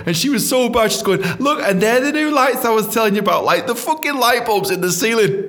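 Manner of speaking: high, loud, giggly storytelling; frantic and amused; laughing panic. Real, not acted.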